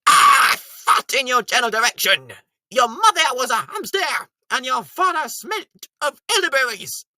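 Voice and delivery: taunting shout from the walls; mocking taunt. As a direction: hurl it like a taunting guard. Monty Python taunt quote.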